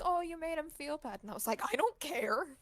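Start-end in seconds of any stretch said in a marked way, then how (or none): 0.0-1.2 high-pitched imitation of a reproach; mock guilt-tripping. Mimics the guilt-tripping girl. 1.6-2.6 higher, exasperated acted retort; retold exasperation. Acts out her exasperated reply.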